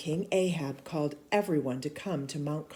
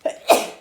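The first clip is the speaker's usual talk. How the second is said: a sudden dry cough; real cough; 12 dB louder than usual. Real, not acted. Reader interrupted, coughing for real. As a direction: cough mid-reading. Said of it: "Excuse me".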